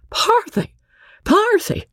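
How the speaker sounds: very high, anxious call of her name; worried husband trying to check his wife; alarmed. Acted. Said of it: "from the alarmed Andy".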